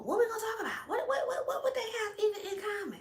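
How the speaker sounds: high, laughing mock voice. Imagining Meghan stuck making chat on a date, mock high voice. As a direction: squeak it out, amused.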